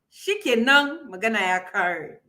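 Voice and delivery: usual speaking voice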